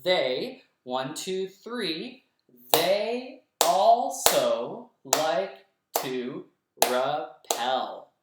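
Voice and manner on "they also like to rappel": very slow and louder, syllable by syllable; clapped syllables. Shown as a demonstration. Teacher claps the second line's syllables for the kids. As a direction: pound out "They also like to rappel" beat by beat. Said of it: "Let's clap it together".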